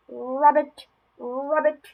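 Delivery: high, loud ribbit with a rolled start; punchy playful ribbits; frog croak. Acted. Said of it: "The froggy says".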